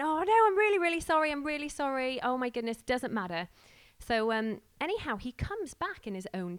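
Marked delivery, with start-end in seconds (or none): 0.0-3.5 high, loud flustered sorry to the driver; gushing apology. Retold or imitated. Apologising to the Tesco driver for a missed slot, flustered and high. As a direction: tumble over your apology, much higher and louder.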